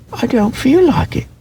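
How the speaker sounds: high, small toy-rabbit voice; a shy little rabbit brushing it off. A narrator's reading. Toy rabbit refusing to play, high and small.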